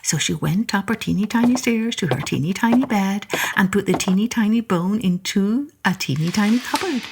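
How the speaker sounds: plain reading voice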